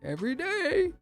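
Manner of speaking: giddy high voice about the software; high excited gushing. Retold or imitated. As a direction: squeal it like a fan.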